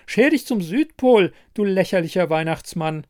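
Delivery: loud, high, scornful dismissal; haughty outburst, easing off at the end; contemptuous. A narrator's reading. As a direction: send them packing with open contempt. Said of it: "fragte sie verächtlich".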